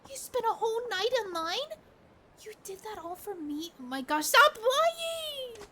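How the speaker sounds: put-on high, delighted young woman gushing; thrilled girlfriend opening her present. Girlfriend disbelieving the gift.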